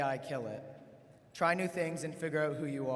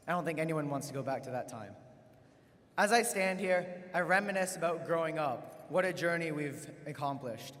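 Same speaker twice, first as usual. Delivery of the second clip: usual speaking voice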